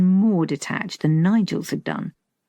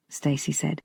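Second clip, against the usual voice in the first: ordinary delivery, nothing marked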